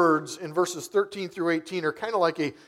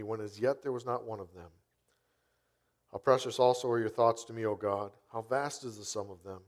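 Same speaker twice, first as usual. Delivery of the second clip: scripture reading; 4 semitones lower, 4 dB quieter than usual. Acted. Pastor reading the psalm to the congregation, soft and reverent. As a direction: read the verses softly, like a prayer. Reads Psalm 139 aloud.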